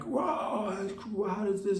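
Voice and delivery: high, mock shocked whoa; mock surprise. Bystanders reacting to his scratch, mock shocked. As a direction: imitate gasping bystanders, lightly. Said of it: "they're like".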